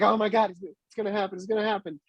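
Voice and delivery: high, tense retelling of nervous anticipation; amped-up panic. Retold or imitated. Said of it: "I was getting all amped up".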